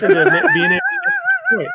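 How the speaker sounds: talking through high laughter; words squeezed out through giggles; laughing. Real, not acted.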